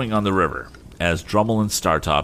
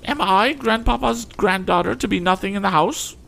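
much higher, outraged lady; indignant lady. Acted. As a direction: accuse him in a high huff. Mrs Pocket wounded pride.

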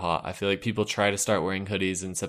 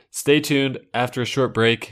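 radio host; 3 semitones higher, 4 dB louder, slower than usual. Acted.

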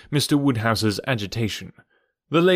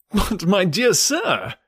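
higher, drawn-out remonstrance; protesting cry; 7 semitones higher, slower than usual. A narrator's reading. Mister Weston objecting to an early departure, exclaiming in protest. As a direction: protest with a high, slow, swooping plea. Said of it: "cried Mister Weston".

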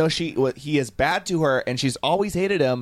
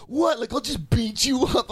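mock tough guy; 9 semitones higher than usual. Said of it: "he's, like, challenged".

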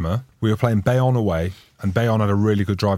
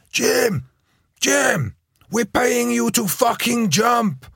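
much higher, slow furious yell; ballistic coach yelling; 11 semitones higher, much slower than usual. Retold or imitated. Coach screaming at his line-out jumper, high furious yell. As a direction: rage at the jumper from the touchline. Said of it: "He's going ballistic at me".

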